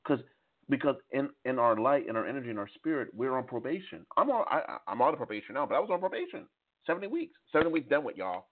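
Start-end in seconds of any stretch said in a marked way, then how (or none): none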